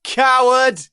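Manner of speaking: loud high mocking yell; jeering shout. A narrator's reading. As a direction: shout the insult from the crowd. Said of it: "someone shouted".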